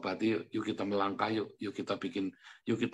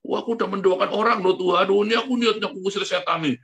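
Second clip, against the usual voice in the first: mock-excited bragging, higher, louder and fast; boastful pleading to God; 7 semitones higher, 5 dB louder, faster than usual. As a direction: show off your miracles to the Lord, rushing. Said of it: "Bukan orang yang berseru kepadaku".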